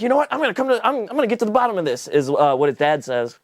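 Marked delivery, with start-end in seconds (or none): 0.0-2.1 higher-pitched, lightly mocked dad resolve; mock-determined dad. Dad vowing to investigate the vampire, mock-determined. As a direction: imitate a dad taking charge.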